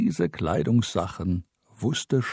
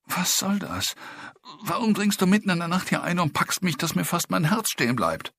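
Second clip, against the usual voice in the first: put-on startled boy; 9 semitones higher, faster than usual. Boy startled awake at night.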